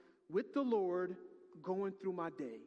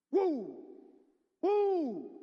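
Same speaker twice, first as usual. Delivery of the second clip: loud, high celebratory whoop; praise-break woo; joyful woo; 8 semitones higher, 9 dB louder than usual. Real, not acted. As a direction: shout it like a win. Celebrating answered prayer.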